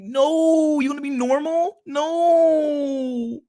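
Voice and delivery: Jordan Peterson impression. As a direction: scoff it out like a stern guru.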